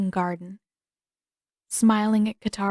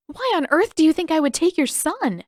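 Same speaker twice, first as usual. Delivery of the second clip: put-on high, louder pleading girl; frightened protest; 8 semitones higher, 5 dB louder than usual. A narrator's reading. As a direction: plead in alarm. Katara facing shovel threat.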